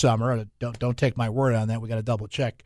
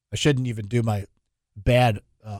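ordinary talk

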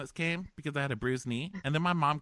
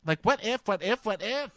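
mock-frantic, higher and louder; frantic rambling; 10 semitones higher, 5 dB louder than usual. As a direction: spiral into panicky what ifs. Hannah's spiraling rant parody.

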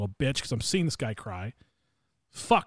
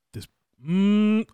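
louder, higher growl of frustration; retold anger; 6 semitones higher, 5 dB louder than usual. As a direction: re-enact being pissed off, cut into a tense "mm". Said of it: "I was pissed, dude".

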